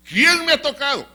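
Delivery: loud, high demand to a crowd; calling out. Acted. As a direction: call the question out over a crowd. Jesus repeats his call to crowd. (pregunta lanzada a gritos)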